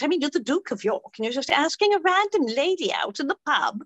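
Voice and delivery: bright British pub lady; British accent. Acted. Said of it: "I've been British all week".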